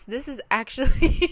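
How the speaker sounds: breaks into a laugh mid-sentence. Real, not acted. Laughing at herself while vlogging about resolve. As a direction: laugh it out, caught off guard. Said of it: "I'm laughing".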